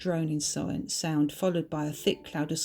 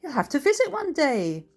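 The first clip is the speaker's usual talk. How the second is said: higher-pitched, lilting Scottish voice; Scottish accent; 6 semitones higher than usual. A narrator's reading. Bertha invites the women to the Highlands, Scottish accent. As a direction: roll it out in a hearty Scottish accent. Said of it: "What a wonderful Scottish accent you have".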